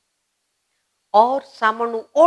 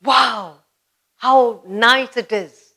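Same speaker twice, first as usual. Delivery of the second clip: delighted; 2 semitones higher, 5 dB louder, much slower than usual. Retold or imitated. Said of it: "You're feeling great".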